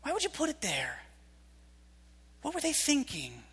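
soft, puzzled question to God; hushed bewildered prayer; baffled prayer. Retold or imitated. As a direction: ask God softly, confused. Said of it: "find yourself saying".

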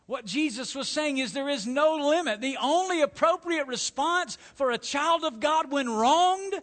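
high, loud, emphatic pulpit delivery; full-force preaching. Real, not acted. Preacher telling believers there is no limit, preaching at full force. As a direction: drive the point home to the congregation.

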